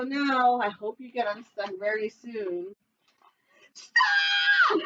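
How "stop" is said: very high shouted wail. Acted. Bird shrieking at friends to stop, alarmed. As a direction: scream it in alarm. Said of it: "Looney Little wailed".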